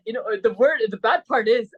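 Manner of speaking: usual speaking voice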